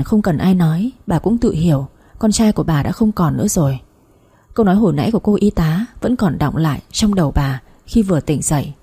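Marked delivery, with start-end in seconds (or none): none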